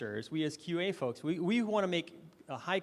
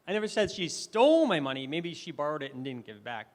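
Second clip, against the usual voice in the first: stole raised and loud; stress on stole; 3 dB louder than usual. Shown as a demonstration. Speaker demoing stress to the audience, stress on stole. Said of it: "I emphasized different words".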